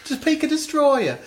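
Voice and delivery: high, piping mock-British voice; British caricature in falsetto; British accent. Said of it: "should we do it in a British accent?".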